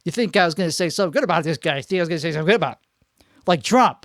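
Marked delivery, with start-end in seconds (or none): 0.0-1.8 fast aside. Real, not acted. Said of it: "Trump is not". 1.8-3.3 fast aside. Real, not acted. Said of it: "Trump is not".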